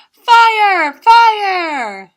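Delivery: very loud, high alarm shout; yelling. Acted. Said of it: "and he yelled out".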